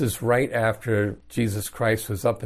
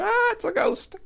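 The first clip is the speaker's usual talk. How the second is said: mock-terrified shriek, much higher; shrieking like a little girl. Frightened fishermen seeing a ghost, mock shriek. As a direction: squeal it like a scared child, hammed-up. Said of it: "shrieking like little girls".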